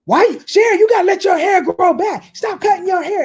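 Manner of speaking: high nagging family voice; mocking family.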